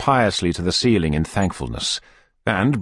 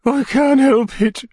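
whimpering; 5 dB louder, slower than usual. A narrator's reading. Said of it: "whimpered the lady".

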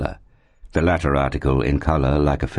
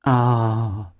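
long, raised sentimental sigh; 6 semitones higher than usual. A narrator's reading. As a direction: sigh it out as a gushing landlady. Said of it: "sighed the good lady".

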